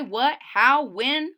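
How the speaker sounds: ordinary delivery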